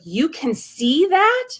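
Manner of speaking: amazed question. Retold or imitated. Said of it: "and she goes".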